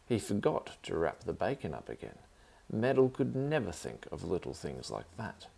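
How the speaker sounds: plain reading voice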